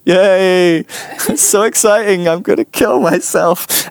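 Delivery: high, fake cheer with a laugh, dead inside; mock enthusiasm dripping sarcasm; sarcastic yay. Stuck with the old ladies, sarcastic high fake cheer.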